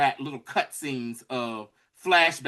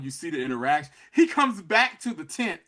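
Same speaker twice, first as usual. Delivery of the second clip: ordinary talk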